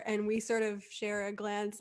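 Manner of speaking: normal talking voice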